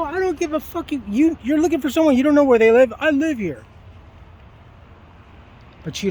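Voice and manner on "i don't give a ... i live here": high, loud, indignant yelling; angry yelling at the woman who rang his doorbell; furious retort. Retold or imitated. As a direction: tear into her, outraged. Yelling at the intruder.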